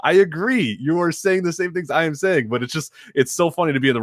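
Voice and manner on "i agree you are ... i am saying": high, laughing frustrated answer; high, exasperated I-agree; exasperated agreement. Retold or imitated. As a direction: throw up your hands, pitched up. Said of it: "And I'm like".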